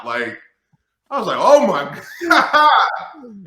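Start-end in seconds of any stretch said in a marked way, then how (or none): 1.4-2.7 high, loud laughing oh my God; laughing disbelief. Retold or imitated. Recalling a stunning taunt, laughing disbelief. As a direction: burst out laughing in shock.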